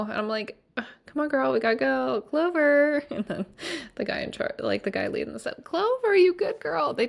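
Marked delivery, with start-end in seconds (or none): 0.8-3.0 higher pleading urge to a horse; coaxing the horse. Retold or imitated. 5.6-6.9 much higher, sing-song praise to a horse; high, loud baby-talk praise; doting guide. Retold or imitated.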